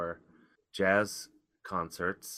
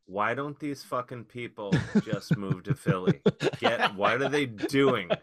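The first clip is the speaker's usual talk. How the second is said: raised, higher-pitched incredulous outburst; exasperated rant; 5 semitones higher, 7 dB louder than usual. Retold or imitated. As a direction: let the exasperation burst out loud. Voicing his exasperated thoughts about bar crowd.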